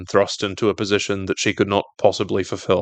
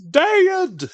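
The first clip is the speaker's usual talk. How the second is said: Harvey yelling for his dad; retold yell. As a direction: call out Dad in alarm. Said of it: "Harvey yells".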